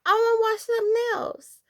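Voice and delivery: high, slow whining plea to sister; mock whimpering kid asking to stop; whiny kid plea. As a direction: beg like a bored little kid. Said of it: "I'm like".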